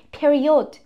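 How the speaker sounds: پریود said the Persian way, 'peri-od'; retold learner saying پریود with a clear 'od' ending; Persian-style mispronunciation.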